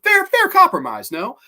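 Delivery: high-pitched, loud mock approval; sarcastic. Real, not acted. Reviewer mocking the jawbreaker bargain, sarcastic high voice. Mocks the sister's cruel bargain.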